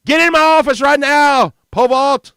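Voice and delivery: high, loud order to get in the office; a principal putting on a show of anger; mock stern shout. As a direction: shout the command for the wife to hear.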